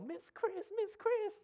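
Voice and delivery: very high-pitched imitation of a daughter's yell; child's excited yell. Daughter calling to a beloved family friend, high imitated yell. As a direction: call out high and thin, imitating a young daughter. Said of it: "she would yell".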